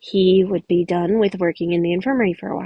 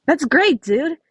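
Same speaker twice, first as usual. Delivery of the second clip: cheerful greeting; 7 semitones higher, 6 dB louder, slower than usual. Acted. Cheerful camper greeting Nico.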